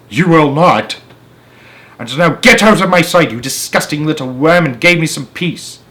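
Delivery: angry aunt. Acted. Aunt orders James away.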